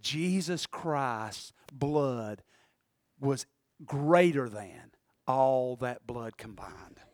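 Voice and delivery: very slow, low, hushed sermon; quiet, drawn-out preaching; radio preacher. Retold or imitated. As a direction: weigh each phrase softly and solemnly. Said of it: "this man's preaching out of Leviticus".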